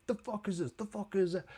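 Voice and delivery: high, softened mock outrage; incredulous demand. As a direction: imitate someone walking in appalled. Acted entrance, demanding what this is.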